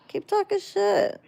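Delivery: high, sing-song dare to keep going; mocking taunt. Real, not acted. Streamer goading a rude chatter, mocking high taunt.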